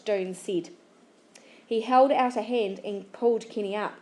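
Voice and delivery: ordinary delivery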